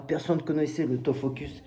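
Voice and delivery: ordinary talk, nothing marked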